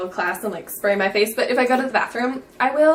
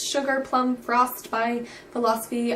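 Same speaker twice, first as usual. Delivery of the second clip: usual speaking voice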